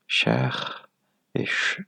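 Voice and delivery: "Cher" and "ch" sounded out as separate syllables. Shown as a demonstration. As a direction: isolate "Cher", pause, then just the hushing "ch". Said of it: "des deux syllabes".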